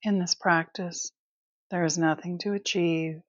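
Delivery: calm, unhurried Yoga Nidra guidance; guided meditation. Acted. Teacher settles listeners into Yoga Nidra, calm guided meditation. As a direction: guide them into rest, leaving long pauses between phrases.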